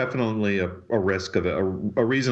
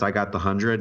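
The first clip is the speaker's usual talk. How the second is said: ordinary talk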